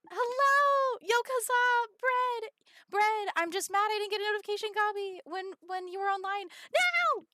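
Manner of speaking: high, loud, bubbly hellos; excited greeting. Real, not acted. Streamer greets Kaza and Brad in chat, bubbling with excitement. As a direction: call out to friends with real glee.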